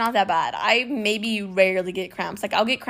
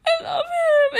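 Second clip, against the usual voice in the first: high-pitched tearful wail; hammed-up sobbing declaration; mock crying; slower than usual. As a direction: imitate being overcome with tears. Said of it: "I just started crying".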